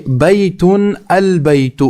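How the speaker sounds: slow, careful demonstration of بیتن البیت; slow teacher model reading. Shown as a demonstration. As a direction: read بیتن البیت slowly, word by word for the class. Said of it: "یہاں پر آپ پہلی مثال دیکھ لیجئے".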